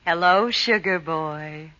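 seductive purr. Acted. Vamp greets nervous assistant.

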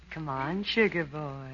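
seductive coaxing. Acted. Vamp coaxing the nervous assistant, softly seductive. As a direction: lure him in softly.

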